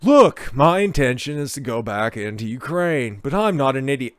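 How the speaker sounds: put-on lazy surfer-dude accent; Spicoli. Host redoing the actor's quote, mock Spicoli accent. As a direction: read it as a stoner movie dude. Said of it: "using proper Spicoli accent".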